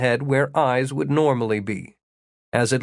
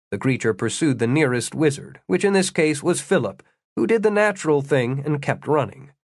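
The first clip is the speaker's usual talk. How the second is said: ordinary delivery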